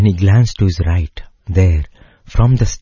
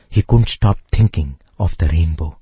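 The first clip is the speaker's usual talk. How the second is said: ordinary delivery, nothing marked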